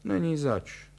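a touch of grouchiness; grumbled reply. A narrator's reading. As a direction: toss it off as a curt grumble. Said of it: "bručel pan Horvát".

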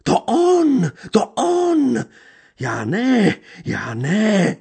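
crazed beggar woman crying from the tree; mad woman's cry. A narrator's reading. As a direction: screech it from above like a madwoman. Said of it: "zvolal nad nimi hlas".

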